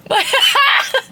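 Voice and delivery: high squealing laugh over her hair. Real, not acted. Seeing her greasy hair on camera, squealing laugh. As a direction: laugh helplessly at yourself.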